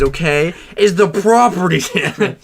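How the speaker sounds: loud, high, slowed outrage; hammed-up rant about repairs; mock angry rant. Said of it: "It's part of the bit".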